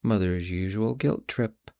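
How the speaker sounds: usual speaking voice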